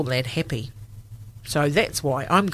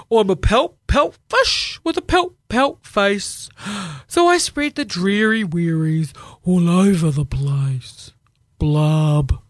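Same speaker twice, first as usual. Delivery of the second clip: glum, droopy pouting fish; gloomy fish voice; 4 dB louder, slower than usual. Acted. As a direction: sulk through the rhyme like a sad fish. Gloomy Pout Pout fish speaks.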